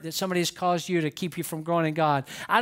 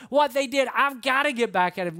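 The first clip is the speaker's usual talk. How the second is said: higher, heated insistence; playing an aggrieved member; acted vengeful protest; 5 semitones higher than usual. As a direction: insist on payback, worked up. Voicing a vengeful church member.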